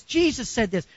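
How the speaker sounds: ordinary talk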